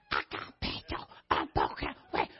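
breathy, voiceless whisper of the request; mock whisper. Shown as a demonstration. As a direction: whisper it like a secret. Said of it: "little teaching lesson".

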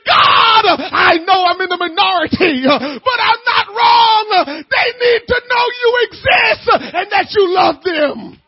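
loud, high pleading shout, voice near breaking; crying prayer. Acted. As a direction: cry out to God with everything. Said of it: "and cry, and say".

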